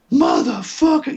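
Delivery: high-pitched New Jersey accent yell, imitating; retold scream. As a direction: shriek it like the singer storming backstage. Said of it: "I hear this almighty screaming".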